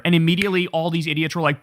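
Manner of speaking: ordinary talk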